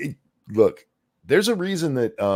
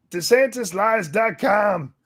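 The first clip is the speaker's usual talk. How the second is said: high, slow shouted mockery of desantis lies dot com; mocking Haley; 8 semitones higher, slower than usual. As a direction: parrot her line, high and slow. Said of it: "We're not petty".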